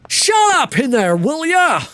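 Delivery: high, loud, slow guard bark; aggressive Johnny. Retold or imitated. Streamer imitating the aggressive Johnny Sasaki, high and loud. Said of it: "He's a lot more aggressive".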